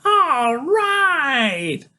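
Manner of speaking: high, drawn-out triumphant shout; excited kid's high cheer; Freak. A narrator's reading. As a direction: whoop it loud and long, way up high. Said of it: "Freak is shouting".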